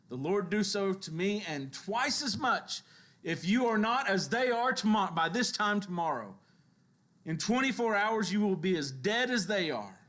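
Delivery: emphatic, hammered-out vow of revenge; threatening. Acted. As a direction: deliver a forceful royal threat. Acting out Jezebel's threat to Elijah.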